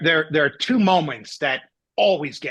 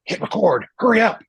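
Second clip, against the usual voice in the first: mock urgent order; 6 semitones higher, 3 dB louder than usual. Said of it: "here's this extremely emotional moment, and it's like".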